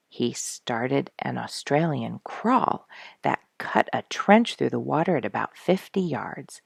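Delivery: ordinary delivery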